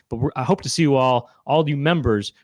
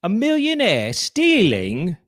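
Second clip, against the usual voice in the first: high, slow copy of an indignant rock star; 6 semitones higher, much slower than usual. Retold or imitated. Copying the other's rock star impression, mock indignation. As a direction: stretch it out, swooping up high. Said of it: "do your [name] impression".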